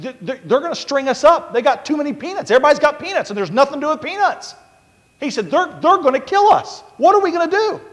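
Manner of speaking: very high, loud panicked stammer; terrified. Retold or imitated. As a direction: squeal it out in terror.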